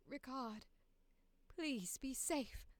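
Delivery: soft, slow plea; tender, soft plea. Acted. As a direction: whisper a worried goodbye to your brother. Tender audition line.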